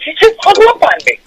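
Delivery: high, whiny disbelief; mock hurt disbelief. Comedian complaining to the young comic, high whine. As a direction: sound wounded and stunned. Comic baffled after hang-up.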